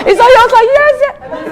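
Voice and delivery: laughing. Real, not acted. Laughs retelling her reaction.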